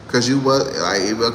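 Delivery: ordinary delivery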